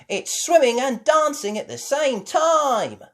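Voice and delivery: loud, high frantic call; yelling over music. Acted. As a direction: shout above the music. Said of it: "yelled Miss Rabbit over the music".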